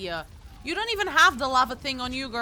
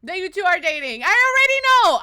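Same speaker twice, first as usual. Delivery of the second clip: excited yelling; 8 semitones higher, 11 dB louder than usual. Retold or imitated. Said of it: "I was just like".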